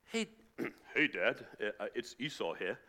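put-on low voice, fumbling; badly disguise yourself as your burly brother; posing as Esau. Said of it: "kind of sounds a bit like Jacob".

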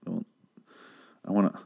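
usual speaking voice

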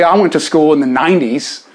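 normal talking voice

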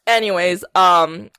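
ordinary talk

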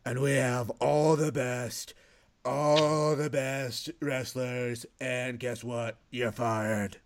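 slow, drawn-out Trump voice; Vince doing Trump. Acted. Host does Vince impersonating Trump, slow and boastful. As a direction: stretch every word like a rally boast. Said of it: "This is Vince doing Donald Trump".

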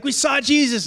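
eager disciples, pitched high; acted-out excited news; excited report. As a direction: blurt it out high, imitating excited friends. Excited disciples quoted to Thomas.

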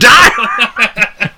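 high yell breaking into laughter; mock battle cry. Mimicking friend finishing off raptor, laughing mock yell. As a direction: shout it as a playful death blow.

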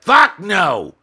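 shrill high furious shout; drunk rage. Acted. Tiny drunk woman snapping at the manager's ma'am. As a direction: spit it out full of drunken spite.

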